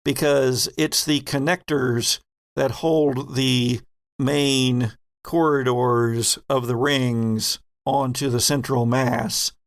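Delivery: ordinary delivery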